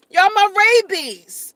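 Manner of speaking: high, loud and slow, proudly naming the fans; gleeful. Real, not acted. Vlogger hyping her fans, loud and gleeful. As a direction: shout out the fan name with glee.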